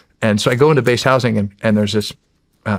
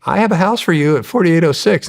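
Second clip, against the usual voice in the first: imitated giddy good news, pitched higher; 6 semitones higher than usual. Housing woman offering the officer a house, imitated giddy. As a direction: mimic her giddy, high-pitched delight. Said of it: "she's, like, giddy".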